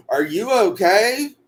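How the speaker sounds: put-on high, slow country-accent question; mock country accent, rising at the end. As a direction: imitate concerned country folk, drawn out in their accent. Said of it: "they say in the country accent".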